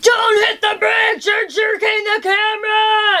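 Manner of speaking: high, loud, drawn-out shout; mock angry yelling. DP yelling at his driver while filming, mock angry shout. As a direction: imitate him yelling at the driver. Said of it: "he's, like, yelling at me".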